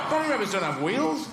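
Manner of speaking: high, indignant disbelief; high-pitched canine bewilderment; baffled greyhound. Acted. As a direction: protest it high, like a duped dog. Greyhound baffled by fake rabbit.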